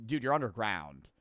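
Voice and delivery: ordinary talk, nothing marked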